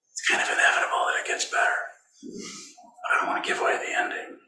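whispered secret, hushed and unvoiced; teasing whisper so as not to give away the ending. Real, not acted. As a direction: lean in and whisper it like a spoiler. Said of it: "So I'm whispering".

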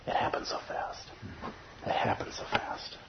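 soft unvoiced whisper. Real, not acted. Teacher stressing to students how fast it happens, whispered. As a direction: breathe it like a secret. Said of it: "whispered repetition".

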